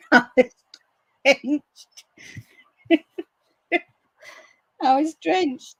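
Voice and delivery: high laughing through the words. Real, not acted. Telling viewers she got soaked, laughing.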